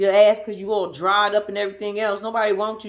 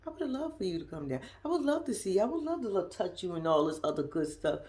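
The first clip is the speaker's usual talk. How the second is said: much softer, tender imitating boyfriend; soft imitation; 3 semitones lower, 10 dB quieter than usual. Imitating the prisoner boyfriend, softly and sweetly. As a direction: murmur it low and sweet. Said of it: "and then he goes".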